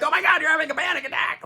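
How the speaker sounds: high, fast, frantic panic; freaking out. Retold or imitated. Friend panicking at someone having a panic attack, frantic. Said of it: "you freak out and you're like".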